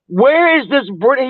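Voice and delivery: usual speaking voice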